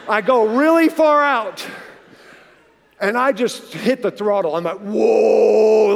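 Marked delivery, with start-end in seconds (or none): 4.8-5.9 a loud, rushing whoa; thrilled whoa. Retold or imitated. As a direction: ride the speed in the sound. Hitting throttle at speed.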